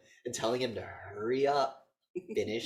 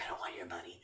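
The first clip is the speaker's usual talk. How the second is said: menacing whisper; 6 semitones lower, 14 dB quieter, faster than usual. Retold or imitated. Killer answering kid, menacing.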